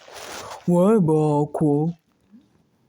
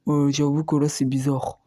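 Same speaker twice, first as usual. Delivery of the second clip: normal talking voice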